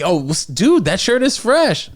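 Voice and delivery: enthusiastic compliment. Shown as a demonstration. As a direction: hook a stranger with over-the-top delight. Demo of a friendly opening hook.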